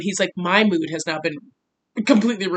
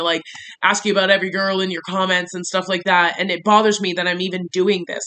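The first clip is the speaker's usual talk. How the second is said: ordinary talk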